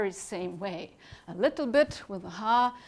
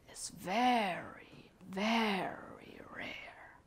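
soft, slow sage voice; old wise turtle; 6 dB quieter, slower than usual. Acted. Wise old turtle teaching the little angel, soft and unhurried. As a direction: explain gently as an ancient turtle, taking time. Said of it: "very old and wise turtle".

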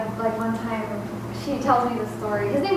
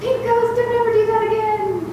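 mom scolding pretend friend; 13 semitones higher, 5 dB louder than usual. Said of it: "she was like".

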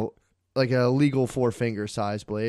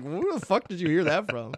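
higher-pitched mock bafflement, amused; retold incredulous question, pitched up; incredulous partner; 5 semitones higher than usual. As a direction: imitate someone thrown by what they heard.

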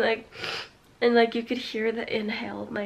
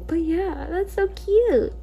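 gushing; 7 semitones higher, 5 dB louder, slower than usual. Real, not acted. Gushing over the dog.

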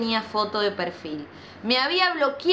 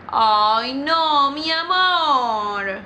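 louder, very slow pitying coo; sympathetic; 4 dB louder, much slower than usual. Real, not acted. Reacting to the crying girl.

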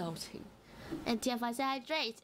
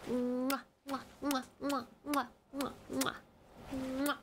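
smacking air kisses; goodnight kiss. Real, not acted. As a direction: blow kisses at the cat. Kissing sounds to cat.

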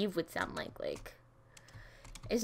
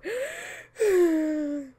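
loud sigh; 9 semitones higher, 7 dB louder than usual. Real, not acted.

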